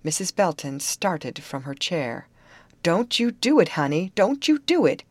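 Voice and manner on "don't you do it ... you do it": raised, higher urgent plea; earnest exclamation. A narrator's reading. Missus Belton warns Grace off the camps, exclaiming earnestly. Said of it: "she exclaimed earnestly".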